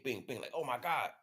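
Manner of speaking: ordinary talk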